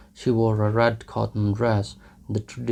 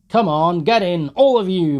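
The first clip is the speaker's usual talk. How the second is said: louder, higher shout; Mikolka shouting; 8 semitones higher, 8 dB louder than usual. Acted. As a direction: yell at them to climb in. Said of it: "Mikolka shouted".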